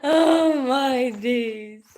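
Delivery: slow, drawn-out sigh of laughter; breathless after laughing. Real, not acted. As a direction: wind down from the giggles. Recovering from a laughing fit.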